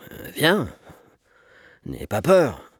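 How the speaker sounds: mildly anxious, halting coaxing; a touch of nerves, hesitant; nervous stammer. A narrator's reading. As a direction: stammer it out with a knotted stomach. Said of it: "balbutia Gontran Pellepoire, l'estomac noué".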